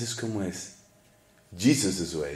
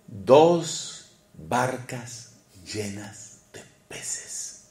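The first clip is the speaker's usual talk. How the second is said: very slow, weighing each word; slow emphasis; 3 semitones lower, 6 dB quieter, much slower than usual. Shown as a demonstration. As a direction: draw it out slowly for effect. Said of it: "repeats the line, then whispers it". (lento y enfático)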